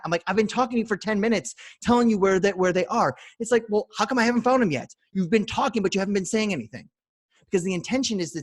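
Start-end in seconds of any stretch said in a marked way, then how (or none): 0.3-6.9 higher and louder, fed-up back-and-forth; exasperated retort. Retold or imitated. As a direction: argue back like you're losing patience. Acted argument over lost keys.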